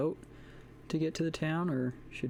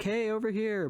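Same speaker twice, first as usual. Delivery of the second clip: raised hailing call; 9 semitones higher than usual. Retold or imitated. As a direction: call across to someone. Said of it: "it would be just sort of like".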